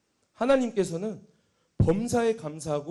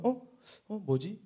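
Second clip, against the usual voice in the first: soft, faltering answers, sinking lower; hesitant confusion; 6 semitones lower, 6 dB quieter, much slower than usual. Retold or imitated. Unsure believer asked again, hesitant and faint. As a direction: answer unsurely, fading away. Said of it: "갈등하면서".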